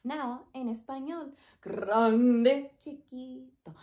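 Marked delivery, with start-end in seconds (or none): 1.6-2.7 loud, stretched word; big voice. Shown as a demonstration. Teacher showing grande in a loud, big voice. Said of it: "big and small". 2.8-3.7 soft little voice; small voice. Shown as a demonstration. Teacher showing chiquito in a soft, small voice. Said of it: "big and small".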